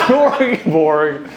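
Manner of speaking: slow, low mock-Swedish gibberish; drawn-out Swedish chef nonsense; mumbo-jumbo in a put-on Swedish accent; Muppet-style Swedish accent. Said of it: "comes from the Muppet Show".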